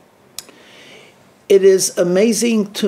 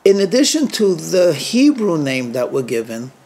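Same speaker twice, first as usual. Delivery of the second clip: usual speaking voice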